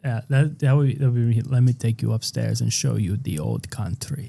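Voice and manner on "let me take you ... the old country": put-on soft, lower Hungarian-accented voice; old Hungarian man. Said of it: "an old Hungarian man".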